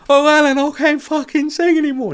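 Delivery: mock upset wail, very high and loud. Upset singer telling comedian she can't go on, mock wail. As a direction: wail it in a shrieking falsetto, hammed up. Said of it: "you're too upset".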